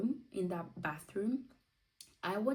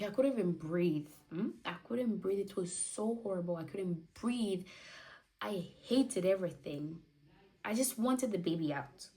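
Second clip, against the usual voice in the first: usual speaking voice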